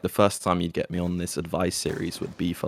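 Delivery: ordinary delivery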